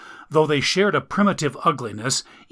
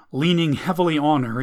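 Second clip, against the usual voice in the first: ordinary delivery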